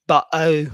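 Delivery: "bo-" for bottle with the middle T dropped; accent variant of bottle. Shown as a demonstration. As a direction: say "bo-" loudly, breaking off the T of bottle. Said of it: "there are different accents".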